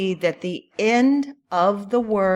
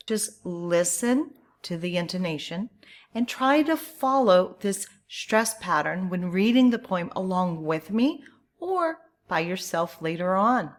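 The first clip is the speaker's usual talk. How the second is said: usual speaking voice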